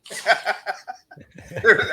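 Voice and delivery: high burst of laughter; real laughter. Real, not acted. Teller fluffing his own punchline, laughing. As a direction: crack up at your own blunder.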